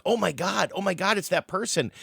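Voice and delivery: lightly played excitement; mock excited recognition. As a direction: gasp like you spotted a celebrity. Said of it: "and I'm like".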